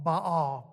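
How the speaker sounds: Baal as one syllable with an open ah; Baal, a single "bahl" syllable; Hebrew Baal. Shown as a demonstration. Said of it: "one syllable".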